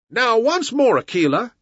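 high, calling command; crying out. A narrator's reading. Mowgli summons Akela to action, crying out. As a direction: call to your wolf in a lifted voice. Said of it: "he cried".

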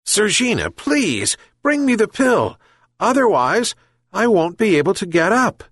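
high, plaintive plea; sad pleading. A narrator's reading. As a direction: beg the cat, mildly distressed. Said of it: "sadly repeated the old man".

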